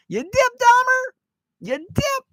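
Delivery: very high, exaggerated upper-Midwest accent; Fargo accent. Man mimicking how locals talk, in a hammed-up, high Fargo accent. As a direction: squeal it in a mock Fargo accent. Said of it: "That's exactly how they talk".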